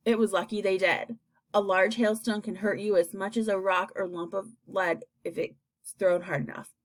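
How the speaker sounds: ordinary delivery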